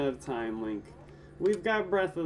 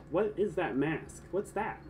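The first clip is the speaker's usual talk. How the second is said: ordinary talk, nothing marked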